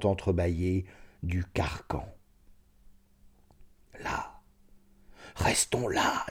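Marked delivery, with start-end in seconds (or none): 4.0-6.3 chuchotement recueilli; chuchoté. A narrator's reading. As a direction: souffler les mots, sans voix. Allongé sous le carcan, recueilli. (Redoux chuchote, couché sur la planche)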